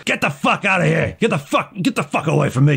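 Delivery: angry character ordering. Acted. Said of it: "Millie's going".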